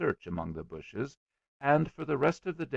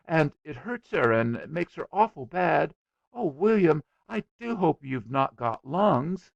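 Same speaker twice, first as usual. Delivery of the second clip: high, louder, fretful concern; anxious little girl; 7 semitones higher, 5 dB louder than usual. A narrator's reading. Little girl hoping friend hasn't got lungs, anxious. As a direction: worry aloud with childlike earnestness.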